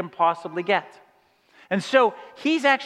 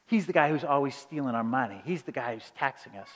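low, soft, hushed grumble about the taxman; hushed complaint; 8 semitones lower, 9 dB quieter than usual. Retold or imitated. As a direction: lean in and mutter it low to Jesus. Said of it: "it's likely that they said".

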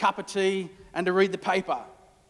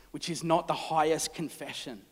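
normal talking voice

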